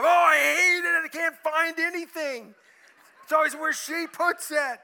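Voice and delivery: husband calling his counselor, shouting in distress; frantic shout. Retold or imitated. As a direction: burst out like a man at the end of his rope. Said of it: "I get a call from him".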